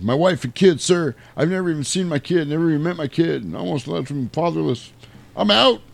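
high, wavering mock-emotional plea; mock tearful. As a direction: play a shaken pilot quitting. Cougar quits, emotional.

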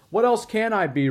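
loud, high-pitched irritation; exasperated retort. A narrator's reading. Scrooge answering his nephew's plea, exasperated. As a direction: burst out in exasperation.

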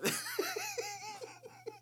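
squealing laugh; shrieking with laughter at the rooting joke; high laughter. Real, not acted. Cohost cracking up, high laugh.

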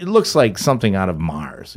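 ordinary talk, nothing marked

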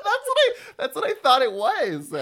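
high, loud laughing agreement. Real, not acted. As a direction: blurt it out laughing.

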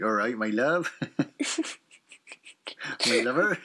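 low, soft Dorset burr; Dorset accent. Shown as a demonstration. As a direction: greet warmly in a Dorset accent. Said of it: "can you do a Dorset accent".

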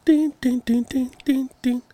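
high sing-song ding ding like a bell; playful doorbell imitation; high chime.

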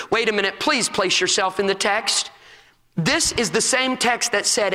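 normal talking voice

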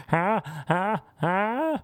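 high, squeaky huh; mock a strange moan; weird orgasm huh. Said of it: "she'd be like".

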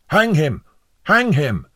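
high, loud cries for execution; demanding. A narrator's reading. Juror demanding the prisoner's death, crying out loudly. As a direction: shout it out, twice over. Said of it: "Mister Heady demanded".

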